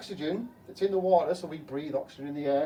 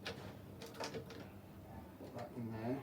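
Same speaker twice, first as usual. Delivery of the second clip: usual speaking voice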